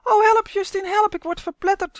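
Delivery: high, loud panicked squeal; tiny creature's frantic cry; shrieking. A narrator's reading. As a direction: shriek for help as if being crushed. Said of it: "gierde Uf".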